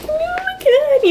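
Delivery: very high, drawn-out giddy squeal. Retold or imitated. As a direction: squeal like a swooning reader. Kicking feet over book boyfriend.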